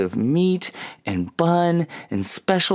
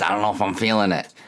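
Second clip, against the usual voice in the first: fast, offhand shrug; casual brush-off; lazy excuse; 5 semitones lower, faster than usual. Retold or imitated. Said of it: "And I'm like".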